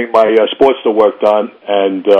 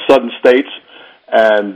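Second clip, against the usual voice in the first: usual speaking voice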